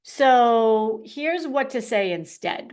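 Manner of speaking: normal talking voice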